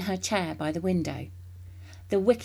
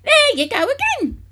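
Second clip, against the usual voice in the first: much higher, loud exasperated cry; loud shrill outburst; cried out; 11 dB louder than usual. A narrator's reading. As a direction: shriek it at the boy. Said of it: "she cried".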